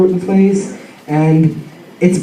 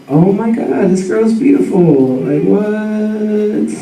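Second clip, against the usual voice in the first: drawn-out, sing-song mock amazement; smitten awe; 3 semitones higher, much slower than usual. Guy spotting a gorgeous girl, smitten mock awe. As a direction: stretch the words, awestruck and lightly hammed-up.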